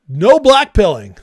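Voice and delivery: loud, high, hammering the word no; emphatic shout. Real, not acted. Scolding his viewers about despair, shouting loud and high. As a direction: bark the rule at the chat.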